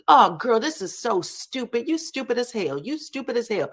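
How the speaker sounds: high-pitched, exasperated mock scolding; fussing. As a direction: imitate someone fussing in irritation. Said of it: "she starts fussing immediately".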